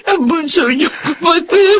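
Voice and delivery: crying out, voice high and breaking; tearful cry. Acted. Celeste tearfully tells her siblings she is their youngest.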